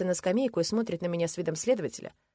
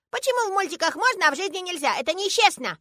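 put-on high, loud childish protest; aggrieved little girl; 13 semitones higher, 6 dB louder than usual. A narrator's reading. Girl pummelling father over unfairness, aggrieved. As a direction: protest like a five-year-old wronged.

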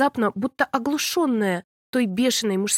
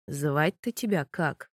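low, slow vampire voice; lazy curious vampire drawl; вампир; 7 semitones lower, 5 dB quieter, slower than usual. A narrator's reading. Vampire asks her name. (низкий, медленный голос вампира)

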